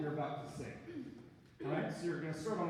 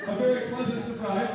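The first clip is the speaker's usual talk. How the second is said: a louder, delighted gasp of surprise; louder mock delighted surprise; pleasant surprise; 3 semitones higher, 8 dB louder than usual. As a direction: react as if pleasantly startled. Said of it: "pretend I just goosed you and you like it".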